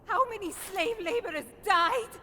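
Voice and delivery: anger climbing in pitch and force; rising outrage. Acted. Furious argument over sparing captive.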